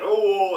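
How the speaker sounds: high, loud mock groan; imitated cry. Retelling the tusk stabbing, imitated loud cry. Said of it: "And he's like".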